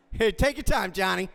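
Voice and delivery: ordinary talk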